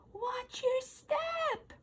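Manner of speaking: high, slow, soft chorus of ants; tiny ants repeating the warning; echoing ants. A narrator's reading. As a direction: echo it faintly from below. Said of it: "echoed the other ants".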